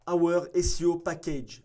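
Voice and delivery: put-on slow, careful English-accented read. Reading the agency's English package name aloud, in an English accent. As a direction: say the offer name as an English speaker would. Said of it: "pardon pour l'accent anglais".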